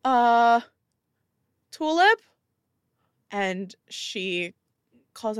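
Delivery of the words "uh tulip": raised, high questioning call; hesitant calling out. Acted. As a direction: call the name uncertainly, pitch jumping up. Startled man calling his wife.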